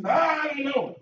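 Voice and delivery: panicked denial. Retold or imitated. Peter denying Jesus a third time, rising and pushed. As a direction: deny it harder, voice rising. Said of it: "forsook him three times".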